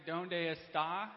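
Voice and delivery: very slow, halting "Donde está?"; slow halting Spanish. Retold or imitated. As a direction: stretch out each word of "Donde está?" slowly. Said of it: "I can say".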